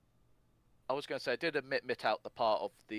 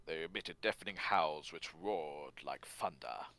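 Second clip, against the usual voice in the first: slow, lower narrator's delivery; Richard Burton; 5 semitones lower, slower than usual. Acted. Host quoting the musical's narrator, slow grave recital. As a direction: intone it slowly and low, like a narrator. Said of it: "having Richard Burton saying".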